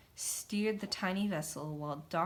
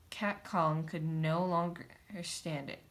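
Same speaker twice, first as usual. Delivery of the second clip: usual speaking voice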